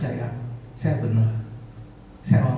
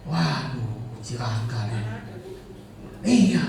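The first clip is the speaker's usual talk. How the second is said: ordinary talk, nothing marked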